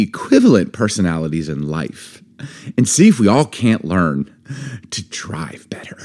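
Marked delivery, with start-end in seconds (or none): 4.9-6.0 soft, slow whispered punchline; whispered tag. Real, not acted. Host whispering a joke ending to the co-hosts. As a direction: drop to a whisper to land it. Joking aside at end.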